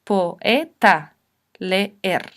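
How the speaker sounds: "Poeta, leer" syllable by syllable, po-e-ta, le-er; vowels separated. Shown as a demonstration. Teacher giving examples of hiatus, vowels separated. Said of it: "¿Cuándo se separan?".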